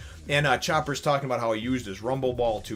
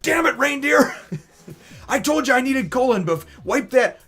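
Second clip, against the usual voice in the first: much higher, louder angry shout; furious yell; 9 dB louder than usual. Retold or imitated. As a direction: blow up at the reindeer. Frankie yells at Chopper.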